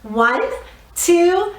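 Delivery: ordinary talk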